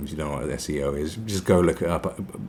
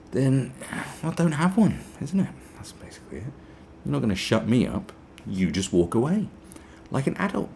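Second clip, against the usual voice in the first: usual speaking voice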